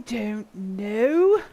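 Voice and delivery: loud exasperated don't know. Real, not acted. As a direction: throw up your hands. Unsure while building.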